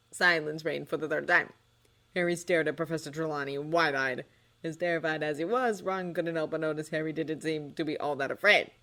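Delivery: ordinary delivery, nothing marked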